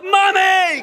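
put-on high, loud frightened kid calling; child's scream for mom. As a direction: shriek for mommy. Kids scared of mummy.

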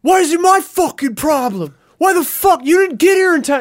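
high, loud furious rant; angry yelling. Retold or imitated. Rude guy in the cinema yelling over a seat, angry. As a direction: go off on him, swearing. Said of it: "crazy, just went off".

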